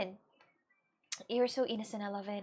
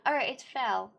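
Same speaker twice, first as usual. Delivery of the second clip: usual speaking voice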